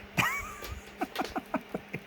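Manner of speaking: high-pitched burst of laughter. Real, not acted. Laughing at his own Macy's parade joke. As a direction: break into a squeaky laugh.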